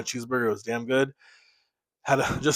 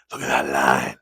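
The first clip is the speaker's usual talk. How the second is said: deep voice; 13 semitones lower than usual. Pretend. Admiring his fresh beard line.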